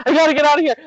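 high, urgent, panicked; panicked yelling. Retold or imitated. Begging husband to let her out, high panicked urgency. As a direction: blurt it like you need out right now. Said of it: "I'm, like, yelling, freaking out".

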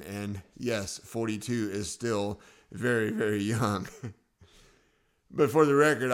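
ordinary delivery

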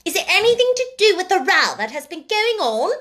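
high, loud, eager schoolgirl; keen, high-pitched prying; eager question. A narrator's reading. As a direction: ask it agog for gossip. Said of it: "asked Lavinia eagerly".

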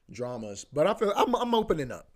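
normal talking voice

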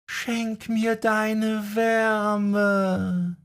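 high, slow, faraway whisper with a sob; slow, high, tearful spectral plea; sweet ghostly mother. A narrator's reading. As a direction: lure him like a lost, cold mother. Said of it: "die süße Stimme seiner liebenden Mutter".

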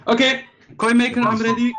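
high, loud announcement of readiness; eager call. Acted. Player hails the coin maker, eager high call.

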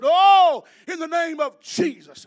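imitating a preacher's high, loud healing cry; mock-fervent shouted prayer, starting big; booming pastor's prayer. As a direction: belt it out like a pastor laying on hands. Imitates pastor praying over him.

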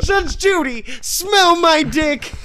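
high, slow comic shout. Pretend. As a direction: yell it up high and drawn out. Courtroom bit, shouted plea.

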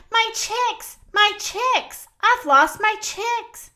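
high, anguished cry for her lost chicks; distressed crying out. A narrator's reading. Mother Hen panicking over her lost chicks, high distressed cry. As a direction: wail it like a frantic mother hen. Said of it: "she cried".

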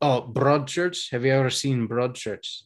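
put-on, lively Scottish accent. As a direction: chat away in a practised Scottish accent.

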